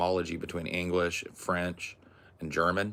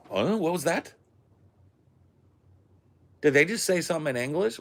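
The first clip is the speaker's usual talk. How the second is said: confused listener; 10 semitones higher, faster than usual. Acted. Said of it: "and you're like".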